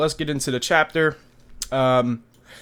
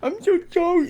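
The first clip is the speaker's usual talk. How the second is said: much higher, wailing mock sob of an apology; mock crying apology. Luffy in a cage begging Nami, mock crying. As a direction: whimper it like a caged crybaby begging. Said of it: "like, crying".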